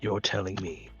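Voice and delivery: menacing low. Acted. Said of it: "subconsciously being menacing".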